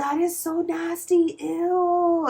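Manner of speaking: high, drawn-out mock disgust; squealed retold revulsion; grossed-out reaction. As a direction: imitate someone recoiling, slow and high-pitched. Bartender's grossed-out reaction, acted.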